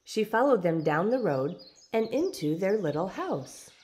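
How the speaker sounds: ordinary delivery, nothing marked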